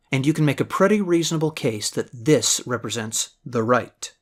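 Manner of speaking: normal talking voice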